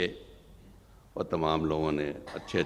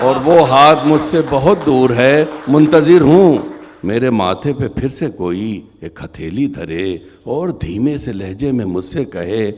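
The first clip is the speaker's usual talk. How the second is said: high, loud impassioned declaiming; impassioned recitation; 7 semitones higher, 15 dB louder than usual. Acted. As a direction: cry the line out to the hall. Repeats line, louder.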